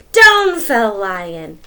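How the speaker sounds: slow, loud heavy fall; dramatic. A narrator's reading. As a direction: drop it like a thud.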